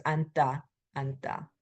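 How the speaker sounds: 앉다 with the second final consonant left unsaid; silent second final consonant. Shown as a demonstration. Teacher gives a pronunciation note, 앉다 with silent ㅈ. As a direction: say 앉다 as "an-ta", dropping the ㅈ. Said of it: "you don't pronounce the second final consonant".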